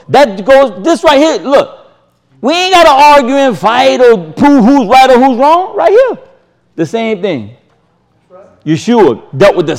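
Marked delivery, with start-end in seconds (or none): 0.1-6.2 fired up. Real, not acted. Preacher to congregation, fired up pointing at the verse. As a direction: lift the voice and drive it home.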